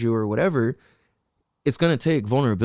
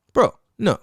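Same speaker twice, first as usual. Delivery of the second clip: blunt stop; 7 semitones higher, 4 dB louder than usual. Retold or imitated. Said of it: "this fool stops and is like".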